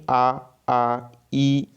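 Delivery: "आ, आ, ई" as slow, steadily held vowel sounds; sustained vowels. Shown as a demonstration. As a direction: hold each of "आ, आ, ई" out continuously. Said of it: "लगातार उच्चारण कर रहा हूँ". ("आ, आ, ई" को लगातार खींचकर उच्चारण)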